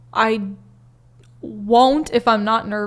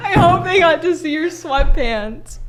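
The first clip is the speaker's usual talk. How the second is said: laughing; 5 semitones higher than usual. Real, not acted. Said through laughter.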